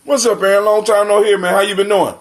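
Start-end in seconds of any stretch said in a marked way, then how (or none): none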